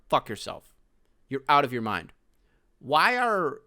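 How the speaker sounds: normal talking voice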